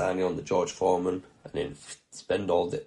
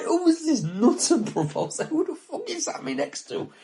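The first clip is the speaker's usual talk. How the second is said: high, baffled exclamation; mock disbelief at the weird kid; incredulous. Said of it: "I'm like".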